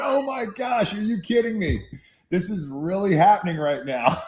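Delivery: high-pitched, incredulous inner voice; retold disbelief. Dad watching son get called into the game, retold disbelief.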